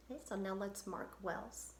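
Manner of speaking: usual speaking voice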